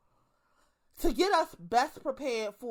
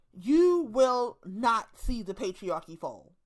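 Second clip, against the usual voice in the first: slow emphatic; 3 dB louder, slower than usual. Real, not acted. Preaching emphatic warning.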